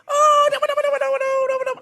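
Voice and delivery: very high, loud squeal of no after no; high Thai impression. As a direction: mimic him jumping way up in pitch when he speaks Thai. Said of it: "he suddenly speaks really, really high".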